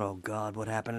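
soft, low hush of dread; fearful inner thought. Retold or imitated. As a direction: murmur it as a worried private thought. Recalls dread at the phone call.

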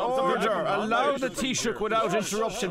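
loud rising calls to order; shouting order order. Acted. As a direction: bark it like a speaker in parliament. Ceann Comhairle calling the House to order.

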